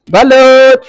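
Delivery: hawker's shout across the street; vendor's call. Real, not acted. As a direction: call out to buyers. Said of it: "sa pagsigaw ko".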